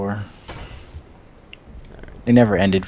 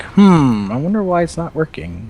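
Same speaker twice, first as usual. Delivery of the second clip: mildly hammed-up wondering, pitched higher; puzzled self-quote; 6 semitones higher than usual. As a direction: act out puzzling over a glitch. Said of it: "And then I'm like".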